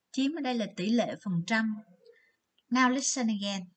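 usual speaking voice

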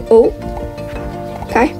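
ordinary talk, nothing marked

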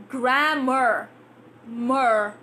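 "grammar" with a flat a and a curled r; American grammar. Shown as a demonstration.

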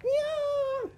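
very high meowing cat. Pretend. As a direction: meow like a cat. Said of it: "猫になりますよ".

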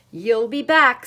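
loud angry retort; stormed. A narrator's reading. Cat calling after the departing duck, stormed. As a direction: storm it out at full volume. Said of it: "stormed the cat".

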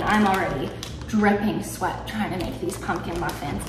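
usual speaking voice

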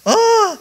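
high, loud cry of dismay; hammed-up "Oh" of despair; mock wail. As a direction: wail like a man who lost his axe. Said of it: "he was like".

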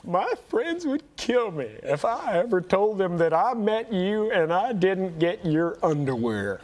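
slow, high-starting mock plea; imitated starstruck fan, drawn out; gushing fan. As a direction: plead slowly like an obsessed admirer. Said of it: "something like".

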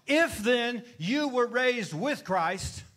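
loud, high, slow preacher's cry; preaching at full force. Real, not acted. Preacher driving the congregation to answer, loud and high. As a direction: stretch every word and lift the room.

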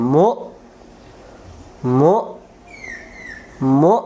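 মু punched out hard with a sharp burst; forceful push on mu. Shown as a demonstration. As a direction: give মু a strong push from the throat. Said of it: "একবারে জোরে করে ধাক্কা দিতে হবে".

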